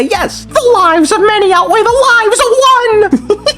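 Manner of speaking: high, slow pleading whine; whiny voice. Acted. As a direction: whine it out like a sulky kid. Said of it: "that same whiny voice".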